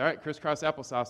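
kindergarten teacher calling out. Retold or imitated. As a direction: lightly imitate a teacher rallying kindergartners. Teacher calling class to sit.

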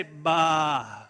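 a long, understated sheep bleat; sheep impersonation. Shown as a demonstration. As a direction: lightly imitate a sheep's baa. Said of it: "your best sheep impersonation".

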